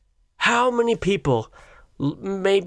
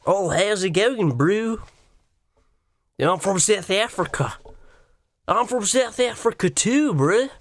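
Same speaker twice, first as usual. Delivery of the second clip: hammed-up South African impression; lively, exaggerated South African vowels; mock South African accent. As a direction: imitate a South African chatting to a mate. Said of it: "all of that fucking brew".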